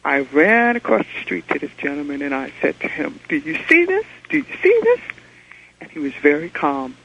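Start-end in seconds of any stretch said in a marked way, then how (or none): 3.3-5.0 high, urgent repeated question; excited disbelief, voice shooting up; frantic asking. Retold or imitated. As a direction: press him, pitch climbing higher. Frantic caller asking the stranger.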